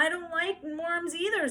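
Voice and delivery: put-on high, tearful child's whine; a small girl about to cry; high tearful whimper; whimpering little girl. A narrator's reading. Little girl near tears.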